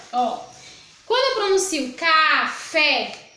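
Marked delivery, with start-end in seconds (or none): 1.9-3.3 "café" stretched into two emphatic syllables, ca... fé; stress on FÉ. Shown as a demonstration. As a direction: pronounce "café" slowly and loudly, landing on "fé". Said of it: "o fé eu falei com mais intensidade". ("café" devagar, sílaba por sílaba, com força no "fé")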